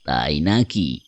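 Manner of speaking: deep, measured stranger voice; mysterious stranger. Acted. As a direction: respond low and grave. Stranger responds to boy. (নিচু গম্ভীর গলা)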